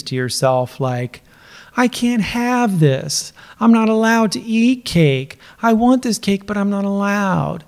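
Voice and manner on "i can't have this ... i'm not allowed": whiny. Retold or imitated. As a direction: whine like a sulking child. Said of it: "Doesn't this sound whiny?".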